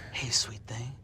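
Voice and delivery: low, soft, breathy come-on; sleazy flirt. Acted. Biker flirting with the player from his motorcycle, low and soft. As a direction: whisper a sleazy pickup line.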